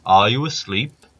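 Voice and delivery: loud, slow giant call. Acted. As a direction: giant booming through the house. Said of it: "The giant awoke and called".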